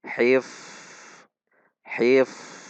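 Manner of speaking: "Hif" with a long hissing f; unblocked fa. Shown as a demonstration. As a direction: let the f of "Hif" run out freely. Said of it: "Tidak tertahan".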